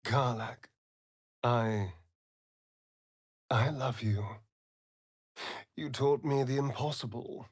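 soft, hushed and tender; tender farewell. Acted. Vampire bidding his love farewell, soft and tender. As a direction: let the words fall gently.

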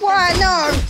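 high, loud cry of dismay; dismayed outburst. Real, not acted. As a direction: shout it as the fight goes wrong. Getting knocked down in game.

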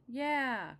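high, loud cubs' cheer; excited cry. Acted. Cubs cheering as they run to dinner, high and loud. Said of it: "cried the cubs".

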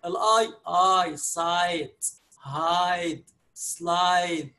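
سايت، هايد، سلايد each with a long ai, slowly; long vowel demo. Shown as a demonstration. Teacher drilling the long ai for Arabic learners, slow. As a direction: model the ai sound slowly in each word. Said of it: "بيتنطق كأنه".

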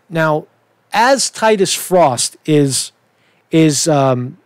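usual speaking voice

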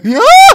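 high squealing laugh; shrieking laugh. Real, not acted. Sees character, bursts out.

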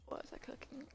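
whispered aside to herself; whispering. Real, not acted. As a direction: drop to a whisper while thinking.

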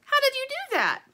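high, loud, astonished question; amazed little bird. A narrator's reading. Little bird marvelling at Dirt's drawing, high and amazed. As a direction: squeal it in wonder as a little bird.

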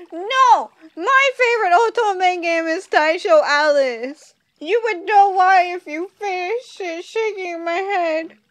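playful scolding; mock indignant. Real, not acted. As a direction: defend your favorite game, offended. Mock indignant about otome.

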